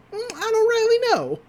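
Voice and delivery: put-on very high-pitched old coot; squeaky high old man impression; old man character. Voicing Old Man McGucket's reply.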